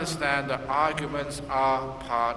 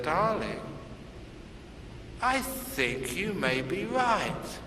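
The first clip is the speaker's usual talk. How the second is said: soft and slow; soft, slow loving surrender; tender concession; 4 semitones higher, 5 dB quieter, slower than usual. Acted. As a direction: give in gently to your wife. Husband gives in tenderly.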